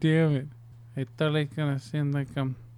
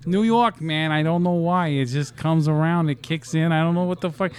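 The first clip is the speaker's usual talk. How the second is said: louder, hammed-up New York accent; 3 semitones higher, 6 dB louder than usual. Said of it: "he's from fucking New York".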